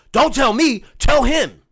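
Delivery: loud, high snapping retort; a manager shouting him off; loud brush-off. Retold or imitated. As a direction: fire it back and shove the blame away.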